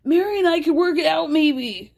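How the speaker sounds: mock screaming. Host mimicking Cody's outburst about Mary, mock screaming. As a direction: imitate an overwrought outburst without full volume. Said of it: "So as he's screaming".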